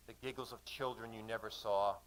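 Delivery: usual speaking voice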